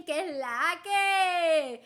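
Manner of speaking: loud, drawn-out Puerto Rican accent; exaggerated Puerto Rican holler; most Puerto Rican. Shown as a demonstration. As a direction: stretch it out in full Puerto Rican style. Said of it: "your most Puerto Rican".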